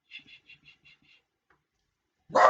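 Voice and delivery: shh the little dog gently, drawn out; shushing the dog. Real, not acted. Hushing her barking Yorkie.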